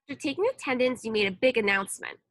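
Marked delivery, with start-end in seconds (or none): none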